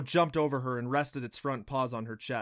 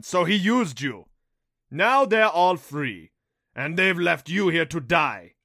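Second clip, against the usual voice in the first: jeering beast; 4 semitones higher, 10 dB louder than usual. A narrator's reading. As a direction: gloat loudly, stretching the words. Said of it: "the Rompo jeered".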